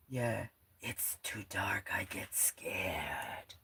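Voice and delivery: slow, spooky whisper, only half serious; mock scared whisper. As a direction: breathe it out slowly, pretending to be frightened. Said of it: "Joking, kinda".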